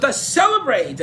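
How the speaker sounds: high, elated cheer; rally everyone to party; jubilant king. Retold or imitated. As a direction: exuberant high-pitched call. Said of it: "he was so happy, he was celebrating".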